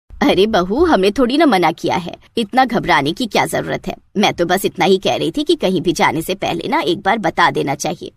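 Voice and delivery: put-on an older village matriarch giving orders; mother-in-law. Mother-in-law voiced by narrator.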